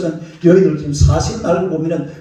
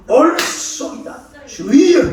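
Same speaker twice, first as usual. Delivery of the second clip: a high, drawn-out cry of agreement; high, slow, eager shout of agreement; eager exclamation; 8 semitones higher, much slower than usual. Acted. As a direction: burst out high and slow, full of conviction. Woman cries out, clapping.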